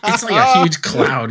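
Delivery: laughing. Real, not acted. Laughing about aerosol scene.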